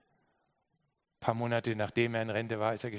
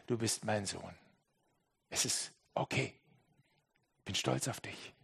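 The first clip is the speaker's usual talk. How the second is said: tender prayer; 3 semitones lower than usual. Acted. Gebet an den Vater. (leises, zärtliches Gebet an den Vater)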